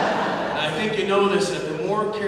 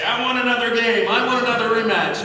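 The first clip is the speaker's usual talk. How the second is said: higher, louder, furious sore loser; demanding rematch; 7 semitones higher, 7 dB louder than usual. Retold or imitated. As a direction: demand a rematch, loud and high. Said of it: "see red and she'd stand up".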